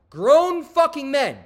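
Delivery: loud, slow and disgusted; slow outraged exclamation; indignant. Real, not acted. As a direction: spit it out slowly in disbelief. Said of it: "outraged rant".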